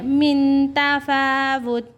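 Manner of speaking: slow, high chant of मिन तफावुत with a single ta; Quran recitation. Shown as a demonstration. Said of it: "ता दो ज़ेर तीन नहीं पढ़ेंगे".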